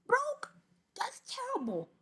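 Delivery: high squeak then whispered verdict; mock horror. Real, not acted. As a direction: squeal broke, whisper the judgment. Mock horror at broke man.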